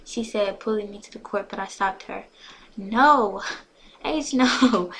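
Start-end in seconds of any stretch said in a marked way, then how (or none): 2.8-4.9 loud, drawn-out no; firm, raised-voice protest; emphatic refusal. A narrator's reading. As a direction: refuse loudly to a pushy friend. Acted firm refusal to friend.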